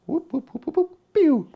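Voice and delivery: high silly whooping noises; high whoop sound effects. Pretend. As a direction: make goofy whoop effects. Sound effects while adding spice.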